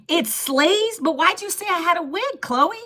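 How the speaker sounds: incredulous. Real, not acted. Incredulous to viewer Chloe.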